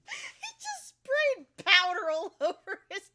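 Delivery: words breaking into high laughter; giggling through the words, slowed; laughing. Real, not acted. Laughing at Riku's flour spraying.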